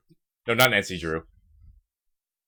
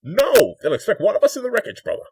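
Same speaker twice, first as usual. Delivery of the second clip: loud villain voice; 13 semitones higher, 8 dB louder than usual. Retold or imitated. Quoting a movie villain.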